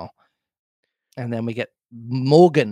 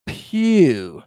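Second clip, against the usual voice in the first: mildly excited pirate, slow and emphatic; 5 semitones higher, 3 dB louder, slower than usual. A narrator's reading. Silver seizing on the blind man's name, excited. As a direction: exclaim the name, stretched right out. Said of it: "now quite excited".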